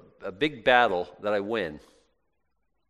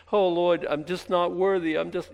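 mock grovelling; 6 semitones higher, 5 dB louder than usual. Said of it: "retold grovelling".

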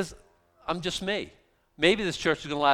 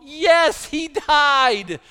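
loud, high, emphatic "yes, he died"; emphatic shout; 9 semitones higher, 8 dB louder, much slower than usual. Real, not acted. Preacher shouting the answer to his congregation, emphatic. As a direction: bursts out with the hard answer.

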